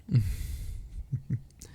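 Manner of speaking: soft airy laugh, no voice; a fond little breath of a laugh; breathy laugh. Real, not acted. Enjoying time together with friend, airy laugh.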